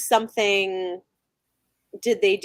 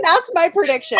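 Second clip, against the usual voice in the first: higher and louder through a laugh; amused insistence; laughing repeat; 7 semitones higher, 5 dB louder than usual. Real, not acted. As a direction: say it again, laughing. Repeating prediction while laughing.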